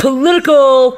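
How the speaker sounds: high, loud, stretched-out word; playful drawn-out call. Pretend. Podcast host blurting a punchline to co-hosts, drawn-out call.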